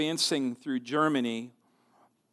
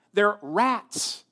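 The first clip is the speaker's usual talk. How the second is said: put-on Southern accent; 6 semitones higher, 3 dB louder than usual. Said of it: "for my southern accent".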